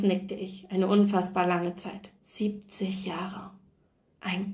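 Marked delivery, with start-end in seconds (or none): 2.2-4.5 slow, breathless whisper of disbelief; awed whisper. A narrator's reading. Nurse repeating the old woman's words in a stunned whisper. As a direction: breathe the number out in wonder, almost silent.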